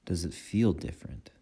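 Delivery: calm guiding voice in short phrases with long pauses; guided meditation. Acted. Meditation teacher guiding listeners' attention through breath and body, calm guided meditation. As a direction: lead them unhurriedly through the breath, leaving space after each phrase.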